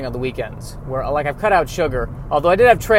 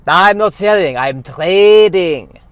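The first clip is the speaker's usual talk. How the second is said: put-on French Canadian accent; 4 semitones higher, 8 dB louder, slower than usual. Trader correcting the general.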